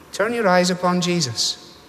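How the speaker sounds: usual speaking voice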